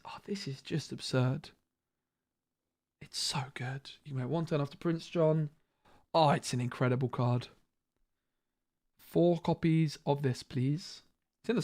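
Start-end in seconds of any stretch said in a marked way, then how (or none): 0.0-5.5 hushed, breathless whisper of delight; excited whisper. Real, not acted. Streamer whispers to viewers about an absurdly strong card, excited whisper. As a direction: whisper it like a secret too good to share.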